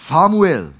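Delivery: loud, drawn-out call of a name. A narrator's reading. Jahwe calls the boy Samuel at night, calling out. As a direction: call the sleeper by name, loudly. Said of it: "Als Jahwe rief".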